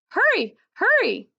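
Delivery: high, loud desperate wail. Acted. Dog wailing at the screen door to be let out. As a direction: wail for someone to open the door. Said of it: "I wail".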